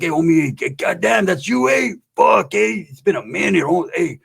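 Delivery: put-on old homeboy hitting you up; lively mock greeting from a homeboy; homeboy impression. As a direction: play the pestering neighborhood buddy. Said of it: "He's like".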